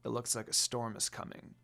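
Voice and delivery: soft, low warning; low murmur. A narrator's reading. Sesshomaru warning the others, low murmur. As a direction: murmur it under your breath. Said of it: "he murmured".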